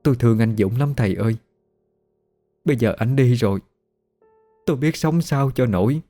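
understated tearful lament of a widow; mildly choked up. A narrator's reading. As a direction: grieve with a slight catch, holding back. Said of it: "giọng nghẹn ngào". (giọng hơi nghẹn ngào, than thở)

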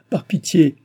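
high, loud plea; pleading cry. A narrator's reading. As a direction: beg loudly for pity. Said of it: "cria Chon, joignant les mains".

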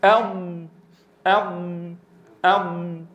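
أض with the air building and stretching forward; correct elongated dad. Shown as a demonstration. Teacher showing students the correct ض. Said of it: "أنا الآن آتي بذات الكيفية".

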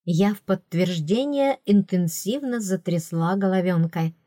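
plain reading voice

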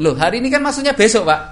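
high, loud innocent excuse; cheeky son. Acted. Son excusing himself to father, high and loud. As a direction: talk back innocently.

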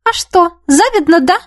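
high-pitched goading question; taunting. A narrator's reading. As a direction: needle a rival. Taunt in acted quarrel. (с вызовом, поддразнивая)

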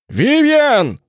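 booming high necromancer call; dark lord. Acted. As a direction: thunder her name from above. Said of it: "громогласно произнёс он".

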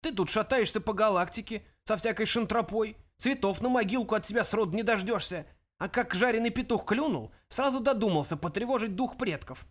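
put-on high-pitched elderly tirade; indignant old ancestor's rant; scolding old ghost. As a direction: berate the good-for-nothing descendant. Ghost scolds great-grandson.

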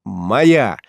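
ordinary delivery

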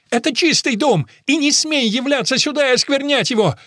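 high, loud, indignant roar; outraged woman at full pitch; enraged tirade. A narrator's reading. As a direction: drive him out with contempt. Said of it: "взревела мама".

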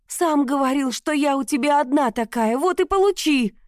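defiant shout. A narrator's reading. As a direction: hurl it at him. Heroine defies husband.

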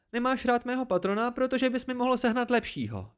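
very high, indignant voice; angry accusation. A narrator's reading. Dena defends her patron, angry accusation.

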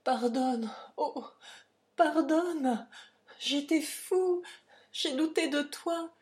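high, halting plea for forgiveness; impassioned, faltering repentance; stammered plea. A narrator's reading. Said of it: "en bégayant".

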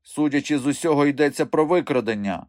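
high-voiced insistent dwarf. A narrator's reading. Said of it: "наполягав Піня". (наполегливо, високим голосом)

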